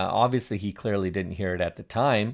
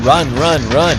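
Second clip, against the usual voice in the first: loud, higher urging shout; urgent yelling; 4 semitones higher, 10 dB louder than usual. Real, not acted. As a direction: yell at him to flee. Urging the fleeing man on screen.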